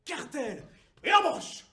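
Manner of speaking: high, loud war shouts; explosive yells from the saddle; vociferous battle cries. Acted. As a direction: bellow short commands as if charging into battle. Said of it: "vous massacrez en vociférant".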